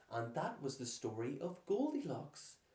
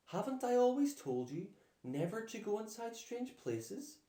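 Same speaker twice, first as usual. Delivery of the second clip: scolding; 8 semitones higher, 4 dB louder than usual. Acted. Mother scolding daughter.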